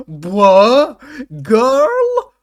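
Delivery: loud, high, exaggerated disbelief; hammed-up shriek of horror at each word; mock shocked outcry. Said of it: "is he gonna be like".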